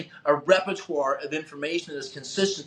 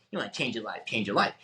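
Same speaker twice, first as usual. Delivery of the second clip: low, soft sarcastic repeat to himself; mocking mutter; 6 semitones lower, 6 dB quieter, faster than usual. Driving around mocking the speaker, low soft mutter. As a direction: grumble the slogan under your breath. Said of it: "I'm driving around Bellevue going".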